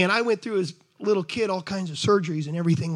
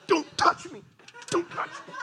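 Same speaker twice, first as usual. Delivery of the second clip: mock squeal; 11 semitones higher, 4 dB quieter than usual. Tense churchgoer acted out.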